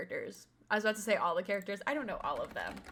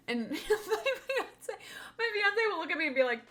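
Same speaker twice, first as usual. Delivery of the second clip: laughing through the words, higher; can't stop giggling while telling it; 9 semitones higher than usual. Real, not acted. Telling chat about the fiance, laughing.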